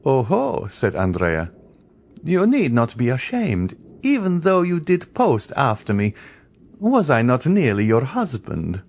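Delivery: high mocking banter; raillery. A narrator's reading. Andrea taunting Eugénie, high mocking raillery. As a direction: tease her cruelly. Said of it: "with this raillery".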